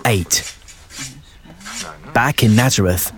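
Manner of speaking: ordinary delivery, nothing marked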